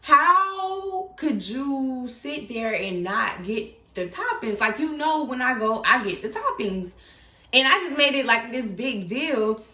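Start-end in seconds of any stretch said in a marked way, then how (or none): 0.0-4.6 slow, whiny teary complaint; mock crying. To the boyfriend who forgot the toppings, a slow teary whine. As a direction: wail it like a hungry child. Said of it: "but I cried".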